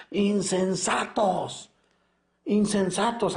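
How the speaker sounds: high, slow, drawn-out cry of Insensatos; Jesus shouting. Retold or imitated. Priest voicing Jesus rebuking the Pharisees, a slow high shout. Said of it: "Jesús grita una palabra".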